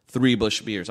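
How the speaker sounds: usual speaking voice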